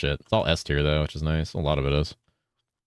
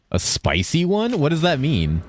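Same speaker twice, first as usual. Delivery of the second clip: incredulous; 11 semitones higher than usual. Real, not acted. Puzzled by chat's odd request.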